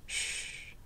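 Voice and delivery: soft, breath-only shushing sound; soothing hush. A narrator's reading. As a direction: gently shush a crying child. Teacher hushing a crying student.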